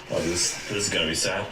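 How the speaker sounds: ordinary talk, nothing marked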